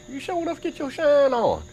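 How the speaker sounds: high, excited catchphrase. Real, not acted. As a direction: belt out the sign-off. Catchphrase sign-off.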